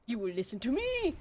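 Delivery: a hint of Zim's commanding shout; Zim impression. After the Zim voice actor clip, a lightly voiced alien command. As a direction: bark it like a tiny alien tyrant. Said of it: "it's just the same intonation".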